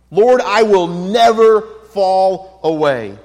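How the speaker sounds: slow, loud, certain vow; confident Peter. Retold or imitated. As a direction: declare it like an unshakable promise. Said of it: "that's Peter's confidence".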